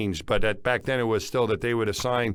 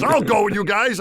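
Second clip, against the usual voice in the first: higher, louder retold offer; excitedly quoted volunteer; eager volunteering; 9 semitones higher, 6 dB louder than usual. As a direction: imitate a friend jumping at the chance. Retold eager volunteering after church.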